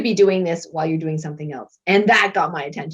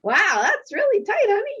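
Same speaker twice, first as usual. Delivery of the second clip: high, light mock husband voice, much higher; husband's remark. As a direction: imitate the husband gently teasing. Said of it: "he's like".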